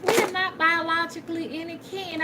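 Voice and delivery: high, incredulous self-quote; high-pitched retelling. Retold or imitated. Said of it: "I said".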